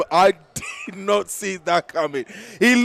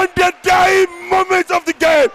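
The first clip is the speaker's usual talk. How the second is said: goal shout; 12 semitones higher, 6 dB louder than usual. Real, not acted. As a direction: stretch every word out in triumph. Last-second goal, commentator erupts.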